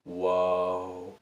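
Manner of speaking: low, hushed, drawn-out whoa; quietly blown away; awed whoa. Retold or imitated. Reviewer recalling the movie's ending, hushed awe. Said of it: "I was thinking".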